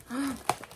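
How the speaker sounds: a quick high gasp of delight; sharp delighted gasp. Real, not acted. Opening the parcel, she gasps quietly at something cute. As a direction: catch your breath at something cute. Opening the box, sees item.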